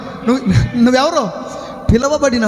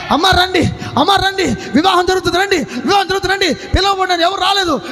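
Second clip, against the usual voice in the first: calling out invitations; 7 semitones higher than usual. Acted. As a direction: shout the invitation down the street. Servant calling wedding guests.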